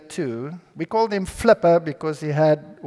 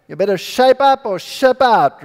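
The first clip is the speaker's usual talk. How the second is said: lightly voiced harsh rebuke, higher and louder; stern scolder; 5 semitones higher, 5 dB louder than usual. Preacher mimicking how churches scold rebellious kids, a light stern bark. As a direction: toss off a mock church scold.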